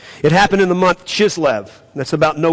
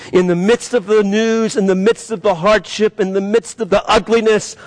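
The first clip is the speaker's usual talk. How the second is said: exalt God with rising passion; impassioned sermon prayer; preaching at full force; 4 semitones higher than usual. Acted.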